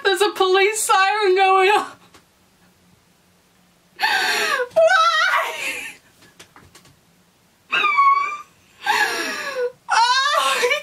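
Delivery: high, tearful wail, half laughing, half crying; real tears mixed with laughter, voice breaking high; laughing through tears. Real, not acted. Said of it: "I'm literally crying".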